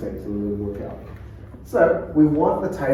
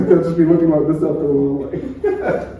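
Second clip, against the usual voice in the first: laughing through it; 4 semitones higher, 6 dB louder than usual. Real, not acted. Coach laughing about awkward staring. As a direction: giggle as you picture it.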